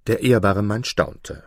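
ordinary delivery

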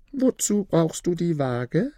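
high, slow character voice; questioning sister-in-law. A narrator's reading. Kasim's wife asks about the scales, probing slowly. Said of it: "fragte Kasims Frau".

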